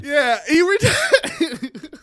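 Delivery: drawn-out laughing disbelief. Real, not acted. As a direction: stretch it out, cracking up.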